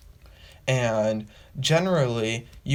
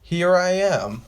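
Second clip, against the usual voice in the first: loud announcing; 5 semitones higher, 5 dB louder, slower than usual. Retold or imitated. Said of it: "So you're telling everybody".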